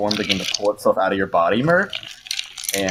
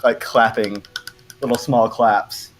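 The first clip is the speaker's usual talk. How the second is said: usual speaking voice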